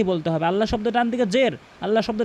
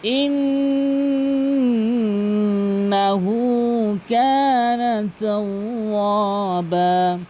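slow, melodic chanted tilawat; slow chanted Quran recitation; much slower than usual. Acted. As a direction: draw out every vowel in a chanted recitation. Recites Surah An-Nasr.

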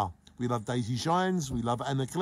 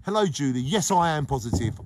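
ordinary delivery

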